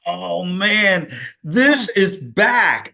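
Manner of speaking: amazed realization. Retold or imitated. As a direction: savor the sudden return of clarity. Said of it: "And I went".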